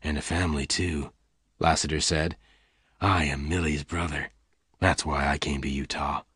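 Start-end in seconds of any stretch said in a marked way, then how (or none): 0.0-1.1 gentle Lassiter. A narrator's reading. Lassiter gently reassures Bess. 3.0-6.2 gentle Lassiter. A narrator's reading. Voices Lassiter.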